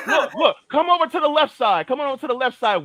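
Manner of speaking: high-pitched rallying call; playful calling out. Pretend. Playfully calling others over.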